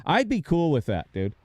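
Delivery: ordinary talk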